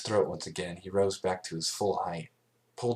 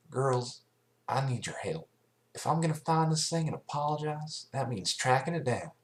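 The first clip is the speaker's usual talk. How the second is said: higher, earnest country pony voice; 7 semitones higher than usual. Acted. Applejack asks her friends to help track it, earnest. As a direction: ask your friends humbly.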